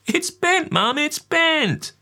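put-on very high, slow whining kid; child whine. A narrator's reading. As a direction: drag out bent in a whining child voice. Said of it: "in my youngest child whine".

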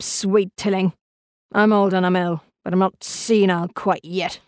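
put-on suspicious, contemptuous old client; testy old-man voice cutting through the pleasantries; crotchety old man. A narrator's reading. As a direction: play an aged invalid, scornful and impatient.

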